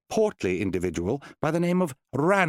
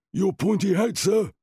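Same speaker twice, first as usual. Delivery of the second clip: higher, rising Your pointy hat, eager servant; ogre Norbert; 9 semitones higher, 3 dB louder than usual. Acted. Ogre assistant handing the wizard his hat, lightly voiced. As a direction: offer it up dutifully. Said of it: "he said".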